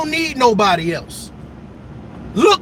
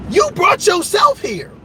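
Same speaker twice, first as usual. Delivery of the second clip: preacher driving point home; emphatic shout; 6 semitones higher than usual. Real, not acted. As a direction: proclaim it to the crowd.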